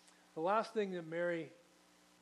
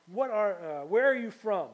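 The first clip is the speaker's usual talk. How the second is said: loud demand; 4 semitones higher, 11 dB louder than usual. Acted. Pilate questions Jesus, raised voice.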